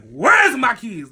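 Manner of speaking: long, drawn-out high holler; loud yell. Retold or imitated. Speaker almost loses it demanding keys from cousin, loud drawn-out yell. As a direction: bellow it slowly, stretching the first word. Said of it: "I end up yelling his name so loud".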